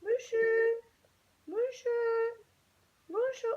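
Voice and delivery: high loud sing-song call; calling the cat to come over. Real, not acted. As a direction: call the cat's name up high.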